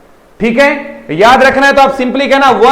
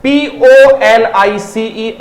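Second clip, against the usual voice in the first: letter by letter, slowly; slow spelling for students; spelled out slowly; much slower than usual. Shown as a demonstration. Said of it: "बात कर लेते हैं pronunciation का".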